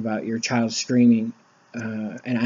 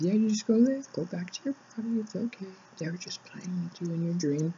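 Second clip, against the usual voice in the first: much higher, much softer, fading into a whisper; gentle whisper; 8 semitones higher, 7 dB quieter than usual. Retold or imitated. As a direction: send her home softly, barely breathing the words. Father sending daughter back gently.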